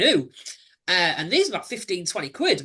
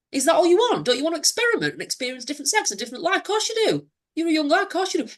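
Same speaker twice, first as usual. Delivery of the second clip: put-on persuasive boyfriend; 10 semitones higher than usual. Abuser voiced, persuading him.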